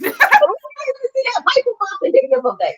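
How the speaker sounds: very high, strained, almost squeaked retort; mock exclaim. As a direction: squeal it out in disbelief. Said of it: "I said".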